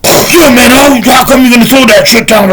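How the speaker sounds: high, loud imitation of a raging kid; loud, high-pitched furious outburst, acted out; enraged. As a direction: burst in yelling, mimicking his rage. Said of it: "he was trembling with rage".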